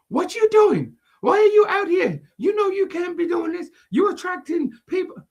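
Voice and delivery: high, shrill-pitched nosy woman voice; hammed-up busybody lady; mock Karen. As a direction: play the meddling Karen scolding a kid. Karen voiced confronting girl.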